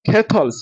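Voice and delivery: "কেটলস" with the double t said as one t; single t sound. Shown as a demonstration. Teacher shows the double letter in "কেটলস" sounded once. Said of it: "এখানে শুধু একটা থি উচ্চারণ হবে".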